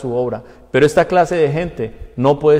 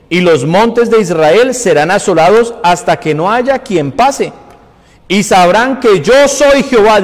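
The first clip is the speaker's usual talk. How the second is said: loud, raised-pitch reading from the pulpit; forceful scripture preaching; 6 semitones higher, 7 dB louder than usual. Real, not acted. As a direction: proclaim God's judgment at full force. Reads scripture at full preaching force.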